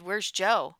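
a touch of alarm in the question. A narrator's reading. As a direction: press for an answer, mildly urgent. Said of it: "Beth's voice went up a step".

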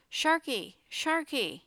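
high, urgent repeated call. A narrator's reading. Jake calls for Sharky at the jammed doors, calling out. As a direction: call a name loudly into a closed space.

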